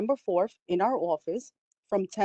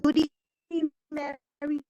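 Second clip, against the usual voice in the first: choppy, cutting-out connection; broken-up call audio; breaking up; faster than usual. Real, not acted. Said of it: "You're coming in broken up".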